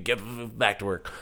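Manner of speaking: low, slow, muttered order from a tired boss figure; grumpy Uncle Sam. Acted. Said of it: "he's like".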